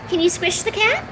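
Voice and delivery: high cooing cue to a pet; cooing request to the cat. Shown as a demonstration. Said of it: "repeats cue whispered".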